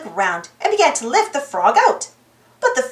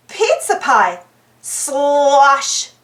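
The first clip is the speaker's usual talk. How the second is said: usual speaking voice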